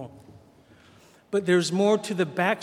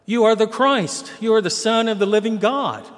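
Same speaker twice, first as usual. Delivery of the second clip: higher and louder, a sudden triumphant answer; eager realization; 6 semitones higher, 8 dB louder than usual. Retold or imitated. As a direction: Peter blurting out the answer he finally sees. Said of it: "Finally Peter goes".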